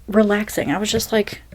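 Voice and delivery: ordinary talk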